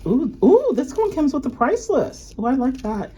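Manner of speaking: high, sudden oohing delight; excited discovery. Real, not acted. Vlogger finding the catalog price list, high excited delight. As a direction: pipe up high at a lucky find.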